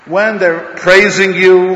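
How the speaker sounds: loud and very slow, stretching out praising; forceful preaching. Acted. Rabbi building his point to the crowd, loud and slow. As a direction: hammer each word home.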